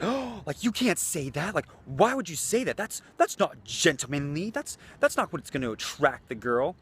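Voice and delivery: high, scandalized voice mocking uptight guys; mocking prissy guy. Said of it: "guys will read this and be like".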